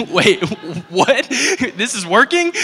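high, laughing, incredulous; shocked giggle as it works; laughing disbelief. Retold or imitated. Friends reacting to the healed man with laughing disbelief. Stunned at the healing.

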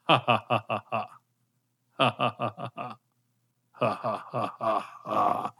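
villainous laugh hinting at doom; sinister laugh. Acted. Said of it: "did you like my sinister f-, laugh of foreshadowing".

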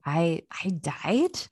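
slow, halting, stunned whisper-like; stunned disbelief. Acted. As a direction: say it like the floor just vanished. Dead man learns he died.

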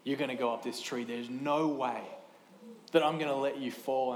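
put-on low, soft reassurance to a scared child; reassuring dad. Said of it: "And I said to him".

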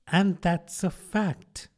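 put-on slow, withering old duchess; drawn-out, scornful duchess voice; frail withering duchess.

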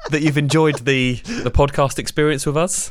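giggling through the words; laughing, can't keep it together. Real, not acted. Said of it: "laughing through sign-off".

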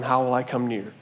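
soft, low, longing repeated question; God longing, hushed. Acted. God longing for his rebellious people, soft and low. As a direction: murmur it twice, aching and hushed. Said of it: "he keeps going".

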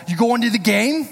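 Boston stranger greeting a Red Sox fan, in a bad Boston accent. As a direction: ham up a Boston local asking about the game, Boston accent. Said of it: "That's my Boston. It's terrible".

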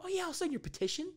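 mock sweet consent; fake agreeable. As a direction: chirp it like a fake persona. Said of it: "And I go".

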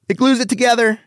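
high, squeaky comic payoff; exaggerated punchline. Shown as a demonstration. Comic working on his delivery, high exaggerated punchline. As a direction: jump up high for the answer. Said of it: "on the delivery".

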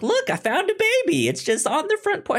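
high mock Nora voice, a bit fast; squeaky high parody of Nora finding a baby. Host mocking Nora's cheerful baby news in a high voice. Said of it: "I didn't like how Nora was then like".

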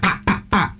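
breathy pop pop gun sounds; whispered gun noises. Retold or imitated. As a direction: mimic gunshots under your breath. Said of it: "I would just go like".

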